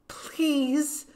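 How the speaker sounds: high, pleading whisper, forcefully pushed out; begging whisper. A narrator's reading. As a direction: beg urgently in a loud stage whisper. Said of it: "I begged in a whisper".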